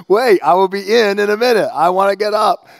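loud high called answer; dad calling to daughter; calling back. Retold or imitated. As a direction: call it to the next room.

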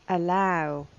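normal talking voice

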